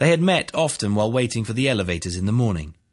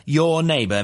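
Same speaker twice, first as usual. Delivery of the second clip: "your" pitched high and louder; stress on your; 8 semitones higher, 5 dB louder than usual. A narrator's reading. Prosecutor confronts witness, high emphasis on "your". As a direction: hit "your" hard and high before "neighbor".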